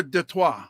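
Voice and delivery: "Detroit" as day-TWAH; French pronunciation. Shown as a demonstration. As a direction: say "Detroit" French, silent final T. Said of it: "in French".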